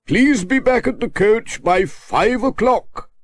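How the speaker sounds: a vicar addressing the trip; the Reverend Timms. A narrator's reading. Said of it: "said the Reverend Timms".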